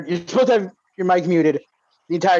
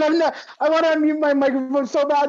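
ordinary delivery

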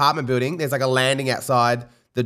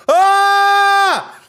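high loud mock scream; imitated old man's yell; 6 dB louder than usual. Host imitating the neighbour's startled yell, high and loud. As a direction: yelp like he's falling off a cliff. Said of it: "I hear this".

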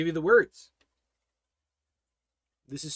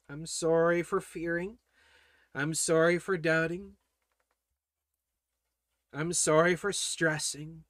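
acted penitent prayer. Believer saying sorry to the Lord for a fit, acted penitent prayer. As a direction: plead gently, pausing between confessions.

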